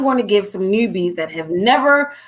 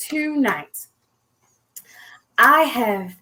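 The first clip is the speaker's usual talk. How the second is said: normal talking voice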